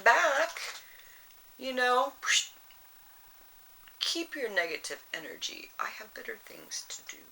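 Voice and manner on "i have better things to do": breathy, quick whisper under the breath; whispered brush-off. Pretend. Brushing off a nagging person in a whisper. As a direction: toss it off in a hushed aside. Dismissing negative people's crap.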